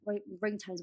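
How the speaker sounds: usual speaking voice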